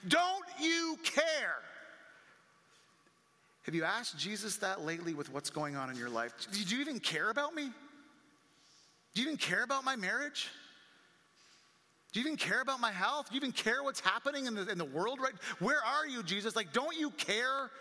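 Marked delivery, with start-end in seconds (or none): 0.1-1.6 very high, loud, long-drawn cry; acted scream. Disciples screaming at Jesus in the storm, acted scream. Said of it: "they were screaming at him". 6.5-17.7 higher-pitched, insistent questions piling up; acted pleading. Believer asking Jesus about marriage, health and kids, acted pleading.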